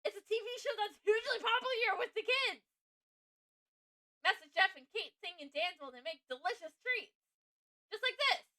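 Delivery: high, loud gushing; excited fan. Acted. Fan describes a children's cooking show, excitedly. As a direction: pitch it up and enthuse like a fan.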